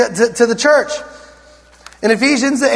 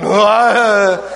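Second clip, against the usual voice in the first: groaning translators; 3 semitones lower than usual. Retold or imitated. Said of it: "Translators back there going".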